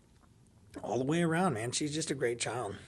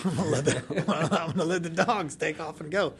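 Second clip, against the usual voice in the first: louder, laughing through it; tickled while telling it; 6 semitones higher, 5 dB louder than usual. Real, not acted. Laughing at own dogs story.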